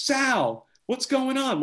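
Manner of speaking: high, bright buddy-buddy hello to Sal; overly chummy greeting. Retold or imitated. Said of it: "like they're long lost buddies".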